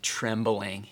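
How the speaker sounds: ordinary talk, nothing marked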